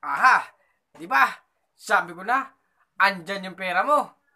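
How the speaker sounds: loud high gloating aha; gloating robber. Acted. Holdupper gloating at the hidden money, loud. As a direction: crow over the find. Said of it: "Sabi ng holdupper".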